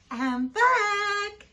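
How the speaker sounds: high, loud, singsong greeting; a teacher sweetly greeting her class; sing-song return. A narrator's reading. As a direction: announce yourself brightly. Miss Nelson returns sweetly.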